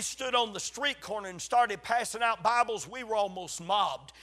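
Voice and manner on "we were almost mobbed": ordinary talk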